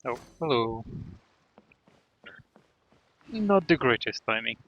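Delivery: ordinary talk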